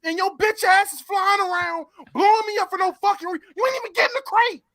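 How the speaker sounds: real gamer rage, pitch climbing; raging. Real, not acted. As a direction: vent at the griefer, exasperated. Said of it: "I can see you raging".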